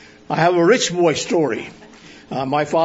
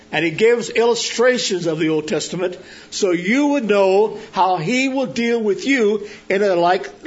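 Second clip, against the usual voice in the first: higher, raised preacher's voice, rising to the pulpit; sermon preaching; 4 semitones higher than usual. Acted. Pastor opening his sermon to the congregation, preaching voice.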